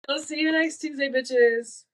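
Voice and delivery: high, giggling send-off; cheeky laughing farewell; laughing sign-off. Real, not acted. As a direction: laugh through the goodbye.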